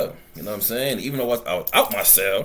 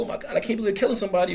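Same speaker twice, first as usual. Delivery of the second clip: quick, higher-pitched mock distress; mock emotional; 7 semitones higher, much faster than usual. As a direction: imitate someone getting all emotional, fast and high. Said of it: "getting all emotional".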